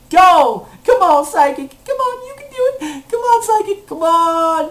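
high, loud egging on; excited high-pitched rooting; urgent cheering. Real, not acted. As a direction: urge it on, voice climbing. Cheering on his Pokemon.

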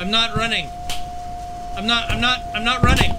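panicking gamer. Real, not acted. Hall monitor catches player.

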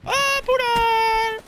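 very high, loud, drawn-out cry; startled scream. Real, not acted. Gamer loses track of an enemy, high drawn-out scream. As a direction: shriek it out long. Outburst during gameplay.

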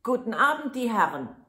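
high, loud taunting cry; loud, cheeky shouted goodbye; mocking call. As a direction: jeer at the men from the mousehole. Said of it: "rief er ihnen zu und lachte sie aus".

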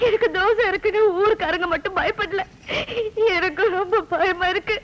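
crying between phrases; tearful. Acted. Granddaughter confiding her fear to grandfather, crying. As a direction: plead through sobs. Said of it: "ஏம்மா அழுதுகிட்டு இருக்க".

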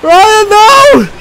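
loud, high alarmed cry; panicked yell. Real, not acted.

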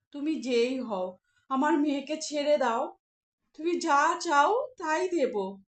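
slow, loud begging with folded hands; mother pleading with the giant to free her daughter; desperate pleading. Acted. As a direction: plead slowly, offering anything. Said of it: "হাত জোর করে বললেন".

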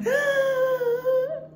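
high, sharp imitated gasp of fright; big gasp. Jumping out of the plane, a big shocked gasp. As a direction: gasp like you just dropped out of a plane. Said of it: "like that kind of face".

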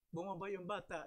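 usual speaking voice

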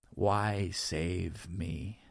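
exhausted whisper. A narrator's reading. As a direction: breathe it out, spent. Whispered question, worn out.

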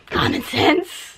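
slow, dragged-out demon growl; sudden slow demon voice. Real, not acted. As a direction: let a demon voice slip out, slow. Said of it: "my demon voice came out".

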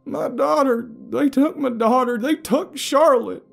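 high, loud frantic wail; anguished cry. A narrator's reading. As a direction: cry out for a lost daughter. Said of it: "cried the sickly man".

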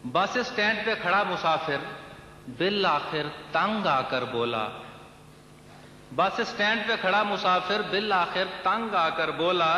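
lilting Urdu verse delivery; poetry recitation. Acted. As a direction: recite the couplet to a listening crowd. Recites short comic verse.

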